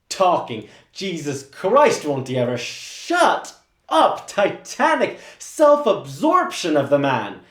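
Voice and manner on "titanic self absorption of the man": normal talking voice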